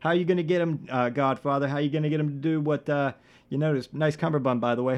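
normal talking voice

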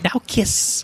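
high, playful meme voice. Retold or imitated. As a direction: squeak it like the meme. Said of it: "the little meme that's like".

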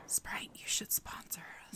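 soft whispered pitch; whispering. Acted. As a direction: whisper it like a sly plea. Pitching sponsor as aside.

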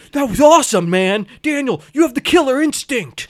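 imitating an excited hype man; hyped-up praise. Acts out Silver hyping up Daniel.

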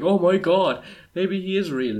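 high, loud imitation of a startled crowd; mock amazement.

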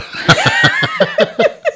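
high squealing laugh; burst of laughter. Real, not acted. Laughing at own prank call.

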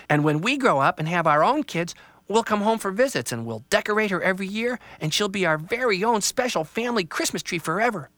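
put-on an eager child pointing out a tree; lightly voiced child. Child tells Dad about the tree, lightly voiced kid.

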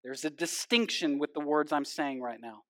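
ordinary talk

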